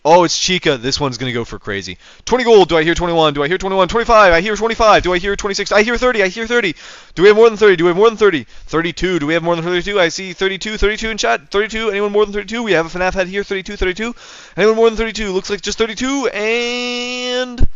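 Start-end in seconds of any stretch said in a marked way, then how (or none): 2.2-16.3 high, loud rapid auction calling; auctioneer voice. Shown as a demonstration. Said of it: "the voice on this next one". 16.3-17.7 auctioneer voice. Shown as a demonstration. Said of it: "you guys like my auctioneer voice".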